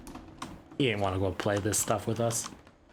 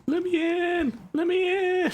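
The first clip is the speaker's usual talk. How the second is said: very high, shrill cry; shrieking. Pretend. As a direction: shriek it like a begging ghost. Shrieking at the door.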